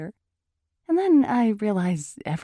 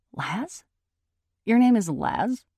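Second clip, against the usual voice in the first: higher, rising, disbelieving questions; incredulous questioning; 6 semitones higher, 5 dB louder, slower than usual. A narrator's reading. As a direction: check the name in disbelief, louder on the repeat. Naomi reacts to his name.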